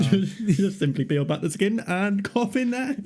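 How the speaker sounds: giggling out a gross joke; laughing through words. Real, not acted. Joking while laughing.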